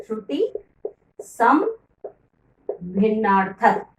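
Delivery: Shruti, सम, भिन्नार्थक spoken as separate words with pauses between; split into parts. Shown as a demonstration. Teacher to children, spelling out a term word by word. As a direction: break Shruti सम भिन्नार्थक into pieces for the class. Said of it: "श्रुति सम भिन्नार्थक शब्द क्या होते हैं".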